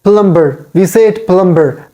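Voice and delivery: "plumber" with the b sounded; "plumber" with an audible b; mispronounced plumber. Shown as a demonstration. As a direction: say "plumber" as plum-ber. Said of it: "it is not plumber".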